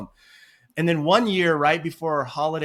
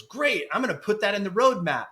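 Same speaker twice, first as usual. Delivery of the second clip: eager, higher-pitched mock yes-man reply; imitated account manager; 7 semitones higher than usual. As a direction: lightly play an over-keen account manager. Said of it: "The account manager goes".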